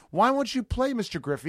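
a hint of pleading schoolkids; schoolchildren asking. Schoolchildren to an injured ballplayer in a sketch, lightly voiced kids. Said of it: "they were like".

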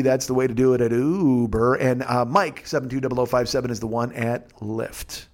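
ordinary talk, nothing marked

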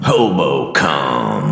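lingering, low catchphrase; slow drawn-out catchphrase. Pretend. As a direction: savor each word slowly. Repeating catchphrase slowly.

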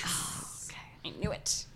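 whispering to herself; breathy whispered I knew it; whispered aside. Real, not acted.

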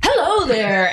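big high hello, overly keen; imitating co-host. Shown as a demonstration. Host retrying her co-host's greeting, imitated enthusiasm. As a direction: greet listeners with put-on gusto, pitched well up. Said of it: "I'm trying to do Mark's voice".

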